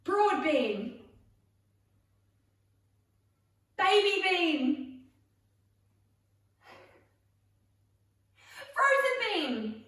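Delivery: loud, high game commands; called out. Shown as a demonstration. Leader calls beans for players to act, called out loud and high. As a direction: stretch and belt out each name. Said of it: "when I call French bean".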